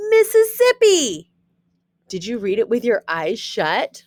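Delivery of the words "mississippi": loud, high triumphant Mississippi; excited. Acted. Teacher showing students she can read with eyes shut, high excited call. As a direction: call the word out proudly.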